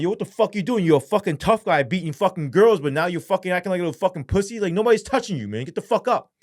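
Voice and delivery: high, loud scolding tirade; retold screaming. Officer screaming at a man curled on the ground, angry. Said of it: "I'm screaming".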